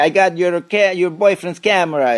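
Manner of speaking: hammed-up French accent, pitched high; a cheesy mock French accent pick-up line. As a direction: Reza mock-voiced in a bad French accent. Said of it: "That's my French accent".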